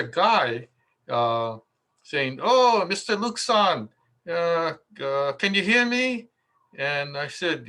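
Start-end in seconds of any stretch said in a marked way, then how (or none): 2.4-6.3 high, louder imitation of a Japanese radio host; lightly put-on Japanese host; mock Japanese accent. Radio host greeting the guest on the phone, mock Japanese accent.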